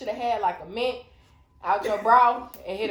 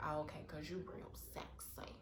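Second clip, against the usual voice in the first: low, very soft mock seduction; 7 semitones lower, 20 dB quieter than usual. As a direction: imitate a drunk woman coming on quietly. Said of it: "this bitch was lit as fuck".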